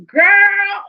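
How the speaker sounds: high, loud exclamation with laughter; real amused outburst. Real, not acted. Vlogger reacting to funny Danny, laughing outburst. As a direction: crack up on a high-pitched cry. Cracking up at the retold line.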